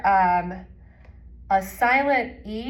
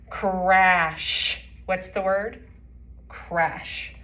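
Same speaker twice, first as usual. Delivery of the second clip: ordinary talk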